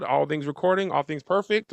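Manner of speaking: normal talking voice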